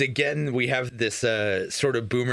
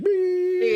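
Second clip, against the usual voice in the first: high, loud imitated monitor beep; 4 dB louder than usual. Describing the unplugged monitor, a high loud imitated beep. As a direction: beep like a flatlining heart monitor.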